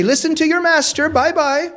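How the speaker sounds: high, sing-song mock dismissal; teasing. Louis teases the fleeing women, high mocking voice. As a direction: wave them off with a smirk. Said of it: "will say".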